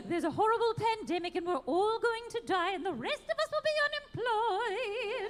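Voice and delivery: put-on sing-song British nanny accent; Mary Poppins British accent. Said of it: "If you sound like Mary Poppins".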